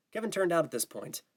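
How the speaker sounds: ordinary delivery, nothing marked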